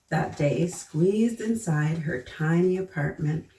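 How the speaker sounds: usual speaking voice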